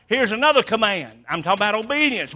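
ordinary delivery

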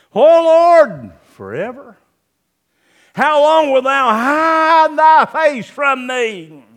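loud, slow lament to God; anguished cry. Acted. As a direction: cry out in despair.

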